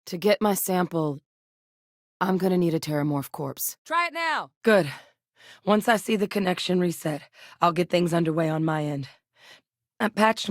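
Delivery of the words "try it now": high-pitched call across to a partner. Acted. Technician telling partner to try it, calling out. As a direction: pitch it up as if to carry.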